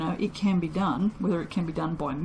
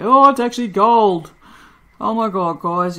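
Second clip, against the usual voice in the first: surprised delight; 2 semitones higher, 10 dB louder than usual. Real, not acted. Soaper amazed her soap came out gold, loud surprised cry.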